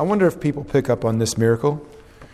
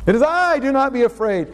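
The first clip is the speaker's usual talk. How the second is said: eager reread; 7 semitones higher than usual. Acted. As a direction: burst out with the line he loves. Rereading the good part.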